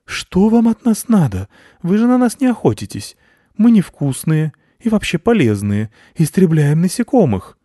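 high, loud squeaky bat protest; worried bat, high and insistent; high squeaky bat protesting to the cat; anxious bat leader. A narrator's reading. Head bat voice.

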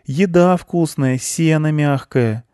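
put-on high, slow childlike voice; contented child. Contented character praises food and hay.

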